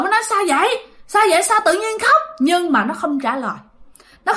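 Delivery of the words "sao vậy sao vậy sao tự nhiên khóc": hỏi dồn, giọng cao vút; chị hoảng hỏi em, giọng cao; hết hồn. Retold or imitated. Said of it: "bạn mới hết hồn". (hốt hoảng hỏi)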